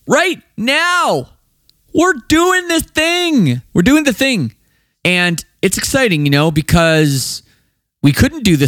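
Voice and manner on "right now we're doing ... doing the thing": high, strained mock cheer; hammed-up excitement. Host announcing the band's tour in hammed-up, high-pitched excitement. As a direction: stretch it out in a high, giddy voice.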